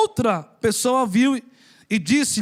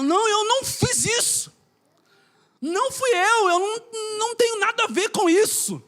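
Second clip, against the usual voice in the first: pleading suspect's denial; 6 semitones higher than usual. Retold or imitated. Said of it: "Porque a entonação".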